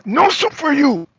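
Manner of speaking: hammed-up soup Nazi; soup Nazi refusal. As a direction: snap the refusal down at him.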